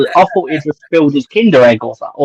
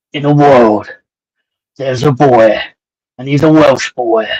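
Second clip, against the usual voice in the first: slow, weighty trailer announcer, breaking into laughter; movie trailer voice; slower than usual. Pretend. Co-host hamming up a trailer for the Welsh boy, mock trailer voice. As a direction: intone it like an epic film trailer, barely holding the laugh. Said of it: "it's gotta be movie trailer voice".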